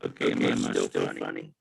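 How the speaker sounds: glitchy low robotic voice; distorted robot voice over a bad line. Real, not acted. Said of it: "It's all messed up".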